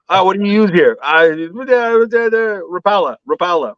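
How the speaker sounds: high, halting imitation of a local fisherman fumbling for the lure name; mock local angler. Narrator imitating a local fisherman asking about his lure, halting high voice. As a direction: play the local angler stammering out Rapala, Rapala.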